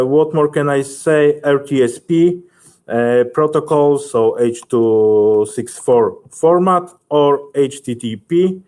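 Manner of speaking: normal talking voice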